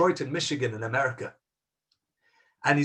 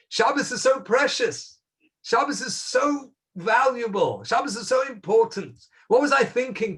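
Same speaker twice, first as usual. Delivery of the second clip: fervent praise; 8 semitones higher, 4 dB louder than usual. Real, not acted. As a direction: lift each line in rising wonder. Preacher extols Shabbos.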